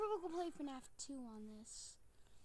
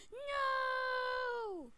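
high, loud despairing no; anguished cry; 8 semitones higher, 8 dB louder than usual. Real, not acted. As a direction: wail it as the game freezes. Game froze, crying out.

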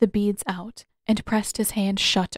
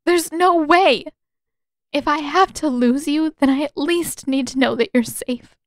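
put-on higher, tearful young woman, pleading and louder; Katara pleading; 6 semitones higher, 5 dB louder than usual. A narrator's reading. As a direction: beg him through rising tears.

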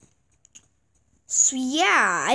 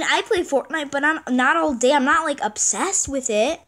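ordinary delivery

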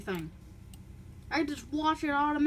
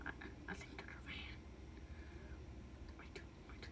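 whispering; 3 semitones lower, 21 dB quieter than usual. Acted. Hushed, spotting a van.

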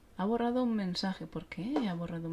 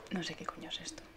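unvoiced baffled whisper to herself; real puzzled whisper; confused whisper; 8 dB quieter than usual. Real, not acted. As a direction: whisper it, lost.

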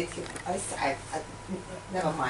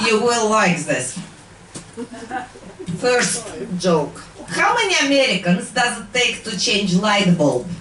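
put-on Russian accent. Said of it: "KGB comedy act".

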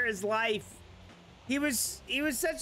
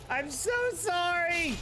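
higher, tearful sorry; 5 semitones higher than usual. Real, not acted. Apologizing again, more upset.

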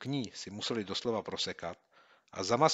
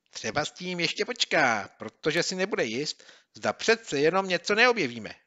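consoling himself; 8 semitones higher, 7 dB louder than usual. Acted. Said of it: "utěšoval se".

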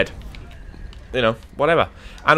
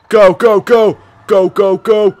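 loud, higher chant of go; excited cheering; 7 semitones higher, 9 dB louder, much slower than usual. Real, not acted. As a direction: cheer the missiles on, then boom. Launching missile attack.

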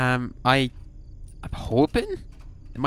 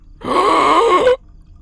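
sharp high gasping shout of fright; loud scared gasp; 10 dB louder than usual. Real, not acted. Streamer startled by a jump scare, loud high gasp.

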